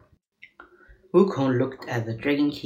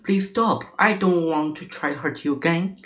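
high-pitched, pained plea; acted desperate begging; pleading; 6 semitones higher than usual. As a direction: beg for mercy, voice raised in pitch. Monkey begs monk to stop pain.

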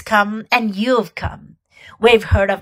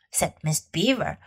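plain reading voice

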